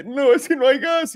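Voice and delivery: high, loud mock whine of panic; hammed-up high voice of panicked Europe. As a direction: squeal it like a panicking crowd. Mocking panicked Europeans over gas.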